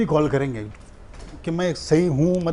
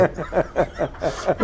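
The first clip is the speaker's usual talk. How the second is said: real laugh. Real, not acted. Laughs after story.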